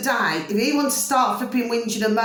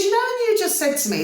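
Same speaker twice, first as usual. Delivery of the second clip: high-pitched, indignant retold challenge; retold confrontation; 10 semitones higher than usual. Woman confronting her new neighbour over his remark, indignant. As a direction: take him up on it, pitched high.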